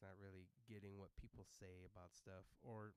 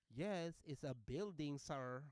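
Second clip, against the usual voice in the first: put-on loud, higher accented reply; impatient lady passenger; 5 semitones higher, 9 dB louder, much slower than usual. Passenger answering confused driver, loud impatience. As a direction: answer as if it were obvious.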